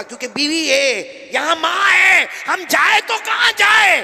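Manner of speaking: high, loud, slow complaining cry; complaining husband. Retold or imitated. Imitating a husband caught between wife and mother, loud and slow. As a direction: wail like a torn husband. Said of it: "कुछ लोग कहते हैं".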